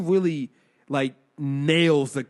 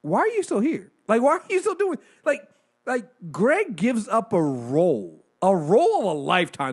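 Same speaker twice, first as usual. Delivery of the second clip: incredulous; 6 semitones higher, 4 dB louder than usual. Retold or imitated. Incredulous questioning of Greg's loyalty.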